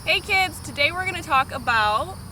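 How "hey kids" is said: ordinary talk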